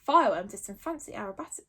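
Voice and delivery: plain reading voice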